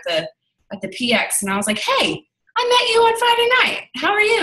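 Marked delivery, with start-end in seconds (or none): 1.8-4.4 much higher, sing-song hello; bright greeting. Retold or imitated. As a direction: chirp a friendly hello. Greeting coworker met earlier.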